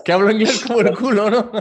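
high-pitched, laughing indignation; laughing disbelief. Real, not acted. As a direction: echo the insult back through laughter. Laughs at insult to his English.

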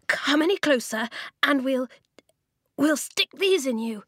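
put-on loud, high girl's voice, forcing courage; brave threat. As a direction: shout it to hold off a bear, voice a bit shaky with nerve. Girl threatens bear with spear.

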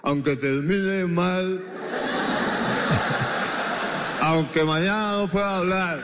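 giggling as the joke lands; laughing punchline. Real, not acted. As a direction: crack up while delivering the punchline. Comic punchline, laughing through it.

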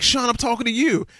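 high, insistent "Sean, I'm talking to you."; impatient coach. Retold or imitated. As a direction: snap it like he's fed up waiting.

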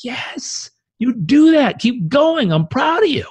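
high, bright cheering on a friend; voicing your best self cheering a friend's success; supportive cheer. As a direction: cheer them on like a proud friend. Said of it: "your best self and you see someone else succeed".

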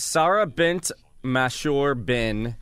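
slow, word-by-word name reading; careful sounding-out. Real, not acted. Co-host trying a long Arabic name, slow and careful. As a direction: sound out each name slowly.

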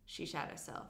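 whispered softly. Retold or imitated. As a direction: lean in and whisper it like a secret. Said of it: "whispers in his ear".

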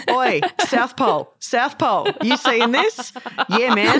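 hammed-up Australian lad voice; Aussie lads. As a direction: call out like an Aussie lad mate. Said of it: "talking to each other exactly like lads".

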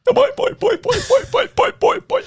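put-on high springy boy boy chant; boing catchphrase. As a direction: bounce it like a cartoon spring.

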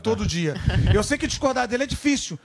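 ordinary talk, nothing marked

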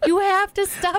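high, insistent plea; imitated begging. Worn-out husband to prankster wife, high begging. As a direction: beg them, pitched right up. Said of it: "begging me".

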